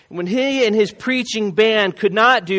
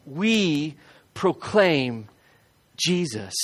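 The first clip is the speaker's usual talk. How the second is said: slow soft emphasis; 3 semitones lower, 5 dB quieter, much slower than usual. Acted. Preacher slows at the climax.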